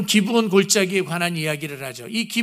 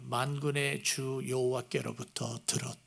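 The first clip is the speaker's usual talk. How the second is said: low, much softer prophetic words; solemn quote; 6 semitones lower, 13 dB quieter than usual. Retold or imitated. Isaiah quoting the Lord, low and hushed. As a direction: hush it like a sacred oracle. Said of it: "이사야가 이렇게 이야기를 해요".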